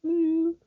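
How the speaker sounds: high thin imitated feedback ring; feedback whine. Musician copying stage feedback to a host, high whine. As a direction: mimic the squeal of a ringing monitor. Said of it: "there's a little".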